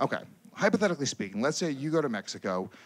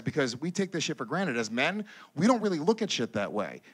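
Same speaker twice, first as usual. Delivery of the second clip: ordinary talk, nothing marked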